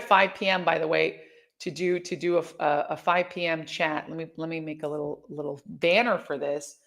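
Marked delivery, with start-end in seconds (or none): none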